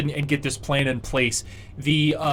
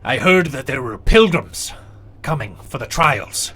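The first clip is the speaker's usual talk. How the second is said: giant speaking; 5 dB louder than usual. Acted. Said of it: "he goes".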